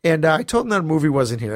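usual speaking voice

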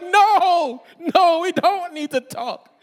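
high, loud, laughing panic; mock panic with a laugh; panicked denial. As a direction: back away from the talk in mock alarm. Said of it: "I'm like".

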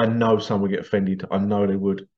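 ordinary delivery

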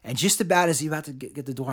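ordinary talk

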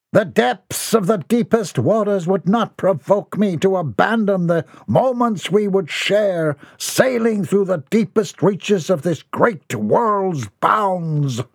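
high, grandiose rapturous raving; lofty, dreamy rant about a ship; ranting old eccentric. A narrator's reading. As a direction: lose yourself in a florid daydream. Mortimer keeps ranting.

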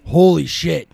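hammed-up astonished customer; mock amazed farmer. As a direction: play up the farmer's delight. Farmer tastes the bacon.

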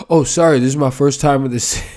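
loud, fast imitated apology; mock flustered apology. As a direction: blurt it as the passenger. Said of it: "he goes".

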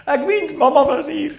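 high, loud, laughing mock sob of a little girl; imitating crying daughter. Father mimicking his crying daughter wishing for her mother. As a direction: wail it like a tearful child. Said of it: "she starts crying and she says".

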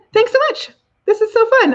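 high, bright gushing thanks; excited gush. Real, not acted. Happy wrap-up gush.

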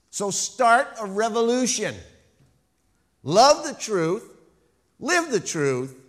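loud, emphatic sermon delivery; ringing pulpit delivery, key words punched; forceful preaching. Real, not acted. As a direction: preach it out to the whole church.

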